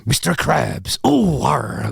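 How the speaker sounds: lightly voiced gruff pirate crab; a hint of a cartoon sea captain growl; Mister Krabs voice. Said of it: "you end up sounding like".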